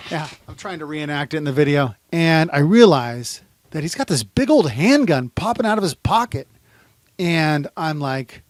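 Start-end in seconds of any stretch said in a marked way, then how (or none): none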